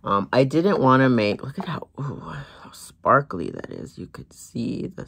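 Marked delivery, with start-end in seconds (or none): 1.4-5.1 ooh, breathy whispered wonder; awed whisper. Real, not acted. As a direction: whisper it, dazzled by the sparkle. Whispering at sparkle.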